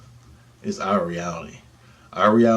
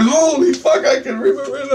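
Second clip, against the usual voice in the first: much higher and louder, half laughing; excited disbelief; 6 dB louder than usual. Real, not acted. Remembering the radio show, high excited laughter.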